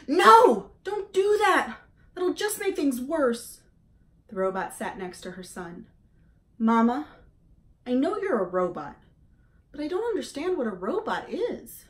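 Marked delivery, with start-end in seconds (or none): 0.1-3.6 put-on much higher and louder, pleading young goose; upset gosling. A narrator's reading. Gosling begs mother not to. 6.6-11.9 put-on higher young goose voice, puzzled; a little bird asking a big question; curious gosling. A narrator's reading. Gosling asks his robot mother.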